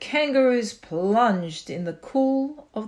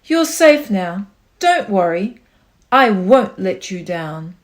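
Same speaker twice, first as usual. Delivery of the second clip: much louder reassuring call; calling back; 3 semitones lower, 9 dB louder than usual. A narrator's reading. Horton calling down to the Who mayor, loud reassurance. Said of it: "Horton called back".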